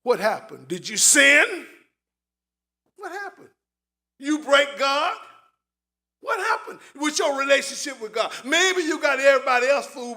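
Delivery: shouted accusations of Job's friends; loud mocking interrogation; accusing friends. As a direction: hurl the blame at him. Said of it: "They want to know".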